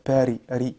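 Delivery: "Paris" with a rolled R; Paris said with a rolled Arabic R. Shown as a demonstration. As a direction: roll the R in "ri". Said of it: "Tu vas dire Paris".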